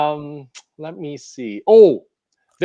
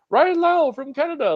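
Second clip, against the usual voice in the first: high, hyped-up ring announcer impression; wrestling announcer; 13 semitones higher than usual. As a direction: imitate a goofy wrestling announcer introducing a contestant. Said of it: "like, a really goofy voice".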